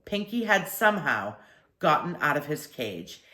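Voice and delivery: ordinary delivery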